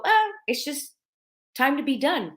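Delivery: light breezy quote. Retold or imitated. Imitating people quitting lightly.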